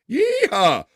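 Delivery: high hammed-up cowboy whoop; cowboy yeehaw. Redneck joke cheer.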